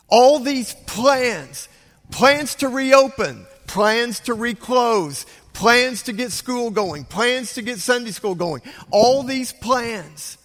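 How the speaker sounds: emphatic preaching. Acted. Pastor driving home the point to the congregation, forceful. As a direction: pound each plan home.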